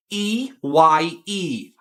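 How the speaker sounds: very slow, each letter set apart; slow spelled letters. Acted. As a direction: spell it out pointedly to the teacher. [name] spelling slowly.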